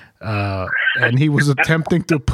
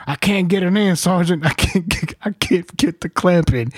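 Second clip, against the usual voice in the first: higher pleading whine, stuck on the task; whiny frustrated corporal; 5 semitones higher than usual. Retold or imitated. Corporal whining to his sergeant that the clamp won't go in. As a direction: act the corporal who can't fit the clamp.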